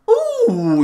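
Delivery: a squeaky, high excited "Ooh"; high-pitched ooh. Real, not acted. Gamer squeals at his player's shot, high-pitched. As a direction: let it jump up high in surprise. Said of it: "He made me do a very high-pitched voice".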